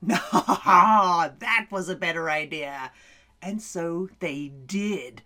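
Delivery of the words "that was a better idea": usual speaking voice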